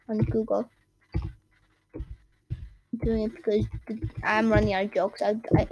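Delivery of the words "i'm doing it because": ordinary delivery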